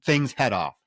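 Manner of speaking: ordinary talk, nothing marked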